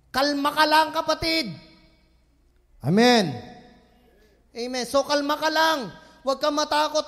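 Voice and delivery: loud, fervent sermon voice; preaching at full force. Acted. Pastor urging the church not to fear, loud preaching. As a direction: proclaim it to the church.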